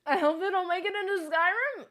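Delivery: high, loud put-on voice; squeaky joke voice. Streamer joking in a high, loud squeaky voice. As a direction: squeal it like a cartoon.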